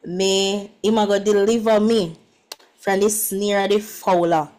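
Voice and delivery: measured Jamaican patois Bible reading. Shown as a demonstration. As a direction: give the verse in Jamaican patois. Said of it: "let me try in patois first".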